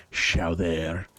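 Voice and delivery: "Chowder" said as French "show-DAIR"; thick mock-French cook's accent. French guy answering Quimby's nephew, thick French accent. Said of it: "French guy comes back and he's just like".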